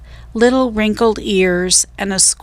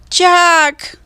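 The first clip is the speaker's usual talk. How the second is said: much higher, louder cry for help; distant cry for help; 10 semitones higher, 5 dB louder than usual. A narrator's reading. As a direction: scream for help from far away. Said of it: "Her voice was louder".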